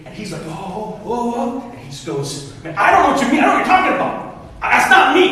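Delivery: normal talking voice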